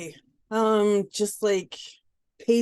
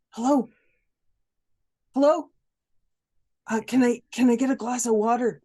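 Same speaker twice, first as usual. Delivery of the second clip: raised, polite plea through the tent flap; higher, louder in-character begging; pleading prisoner; 5 semitones higher, 4 dB louder than usual. Acted. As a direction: beg the guard for water.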